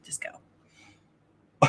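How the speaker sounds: whisper. Real, not acted. Whispered reply to co-host.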